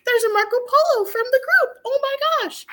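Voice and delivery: high, giddy laughing delight; gushing happy surprise. Retold or imitated. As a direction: squeal over the new message. Delight at a group message.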